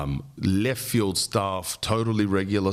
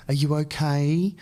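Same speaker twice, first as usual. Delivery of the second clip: higher, worried "are you okay"; concerned women; 9 semitones higher than usual. Retold or imitated. Three women checking on him, lightly voiced concern. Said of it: "they're like".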